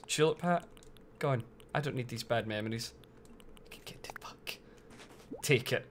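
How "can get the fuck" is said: under-breath whispered curse. Real, not acted. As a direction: hiss it to yourself. Whispered aside.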